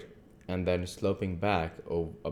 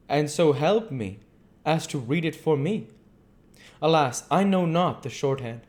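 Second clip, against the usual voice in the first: higher, louder voice for the earnest foreign doctor; earnest old doctor; 9 semitones higher, 5 dB louder than usual. Acted. The doctor to Mina, explaining his visit, grave and courteous.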